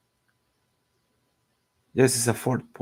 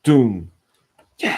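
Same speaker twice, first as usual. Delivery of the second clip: mock doom effect; 3 semitones higher, 9 dB louder than usual. Sound effect for the kill.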